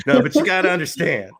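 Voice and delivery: punchier mock host catchphrase; imitating the host. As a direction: lean into his pet phrase. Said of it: "when he says".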